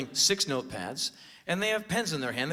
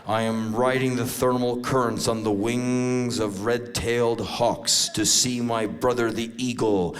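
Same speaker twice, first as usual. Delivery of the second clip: slow, low incantation; solemn vision-quest mystic; 5 semitones lower, 4 dB louder than usual. Acted. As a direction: intone it slowly like a mock shaman.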